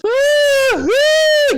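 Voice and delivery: loud, very high long woos; high whooping cries. Retold or imitated. Host acting Furiosa snatched in the tunnels, high whoops.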